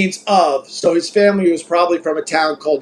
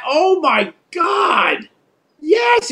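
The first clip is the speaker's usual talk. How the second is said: surprised; 10 semitones higher, much slower than usual. Real, not acted. Recalls the flight name.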